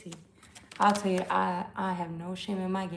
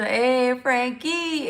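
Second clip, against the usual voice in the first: excited greeting; 4 semitones higher, 9 dB louder, much slower than usual. Real, not acted. Greeting a viewer in live chat.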